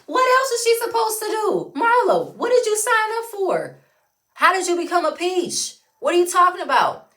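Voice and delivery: high, loud incredulous challenge; exasperated, high-pitched questioning; indignant questioning. Real, not acted. Indignant questions aimed at absent Marlo.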